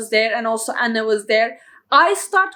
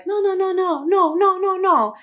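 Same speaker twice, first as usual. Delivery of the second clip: high, repeated no from a horrified mom; mother's flat refusal; 8 semitones higher than usual. Retold or imitated. Daughter says she wants to stay, mother refuses. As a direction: imitate mom shutting the idea down fast. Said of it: "The first reaction of her mother, like".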